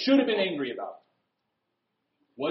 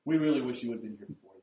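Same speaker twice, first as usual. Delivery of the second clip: passive aggressive; 8 semitones lower, 5 dB quieter, faster than usual. Retold or imitated. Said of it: "passive aggressiveness".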